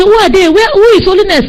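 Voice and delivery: high-pitched, urgent questions; acted bewildered questioning. People in the dream asking about the name, bewildered. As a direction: ask in high-voiced confusion.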